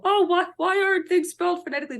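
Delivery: high, quick, whiny-objector parody; mock complainer. Author mimicking people who gripe about spelling, mock complaint. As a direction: imitate a complainer in a high rush. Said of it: "is when people go".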